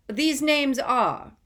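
sharp, loud, high, slow cut-in. A narrator's reading. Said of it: "said Mister Crouch sharply".

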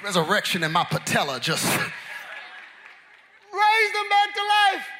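loud, slow preacher's build; full-force sermon delivery; preaching. Acted. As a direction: preach it out to the church.

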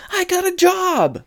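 high squeal of good news; excited announcement. Retold or imitated. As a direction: burst out with it, pitch soaring. Excited job announcement in retold scene.

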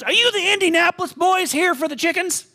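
high, lively country lady voice; Betty's farm lady voice. Retold or imitated. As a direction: play the brisk old farm woman coming up to the truck. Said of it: "She goes".

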